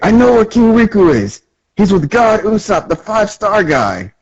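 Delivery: high, excited report; high-pitched cartoon informer; urgent announcement. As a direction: call out the news as if running up. Acted man running up with news.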